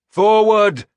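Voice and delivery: roaring the charge over the din; shouted command. A narrator's reading. Warlord ordering his men to charge, loud battle shout. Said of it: "I shouted".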